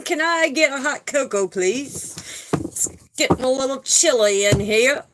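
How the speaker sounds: playfully put-on voice for a laugh; joking silly voice. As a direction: ask it in a silly, hammed-up voice. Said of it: "Just kidding. Had to make you laugh".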